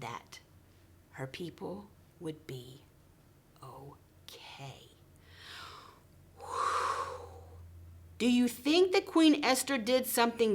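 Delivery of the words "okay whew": relieved sigh. Acted. Relief at story's end.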